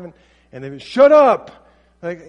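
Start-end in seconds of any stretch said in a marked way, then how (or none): 0.8-1.7 loud high furious yell; teen yelling. Retold or imitated. Teen shouting back at a gentle parent, loud high yell. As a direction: scream it at your parent.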